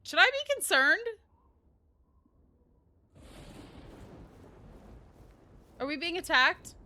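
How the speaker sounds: startled by a sudden attack; real alarm, high and loud. Real, not acted.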